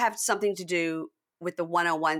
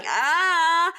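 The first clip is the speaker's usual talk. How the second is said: high, loud excited "ah"; excited squeal; 11 semitones higher, 6 dB louder than usual. Real, not acted. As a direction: let out a high squeal of anticipation. Excited for next week.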